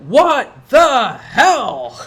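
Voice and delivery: loud, high, drawn-out chant; crowd cheer. Acted. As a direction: cheer each word slowly like a game show audience. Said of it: "The crowd all cheers the show's title".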